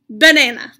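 loud, silly Banana; silly word. Acted. Piggie making the reader say a silly word, loud and silly. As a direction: blurt it out to trick the reader.

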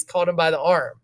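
usual speaking voice

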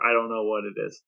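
usual speaking voice

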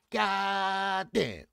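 high, drawn-out holler at mama; exasperated shout. Acted. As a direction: belt it out, stretching the first syllable. Exasperated exclamation in plea to mama.

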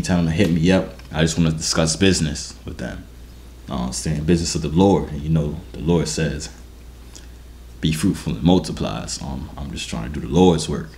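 ordinary delivery

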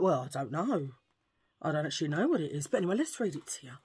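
ordinary talk, nothing marked

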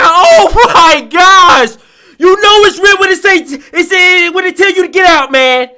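loud, high-pitched burst of panic; startled yelling. Real, not acted. Streamer startled by the game's warning, yelling in panic. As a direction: yelp in fright, voice jumping up.

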